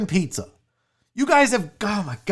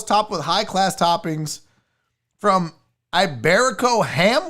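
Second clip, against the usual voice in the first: ordinary talk, nothing marked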